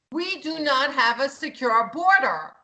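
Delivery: ordinary talk, nothing marked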